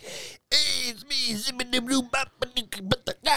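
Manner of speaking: high, zany mock Farley shout; goofy Chris Farley impression. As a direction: bounce in like a manic comedian. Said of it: "being like".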